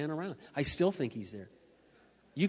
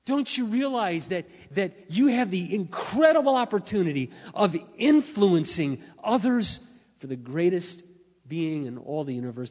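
preaching at full force; 4 semitones higher, 7 dB louder than usual. Acted. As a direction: drive the altar call home. Preaching climax.

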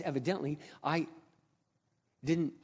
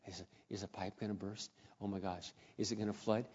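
much softer and lower, fretful; worried man at night fearing a burst; anxious worry; 6 semitones lower, 11 dB quieter than usual. Retold or imitated. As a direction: murmur it lying awake in bed. Lying awake worrying about leak.

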